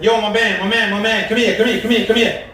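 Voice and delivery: calling out. Retold or imitated.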